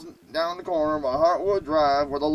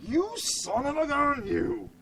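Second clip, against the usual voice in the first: slow, higher-pitched mock scolding; mad as hell; 8 semitones higher, 3 dB quieter, slower than usual. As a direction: drag out the retold curse at the dog. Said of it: "I was mad as hell".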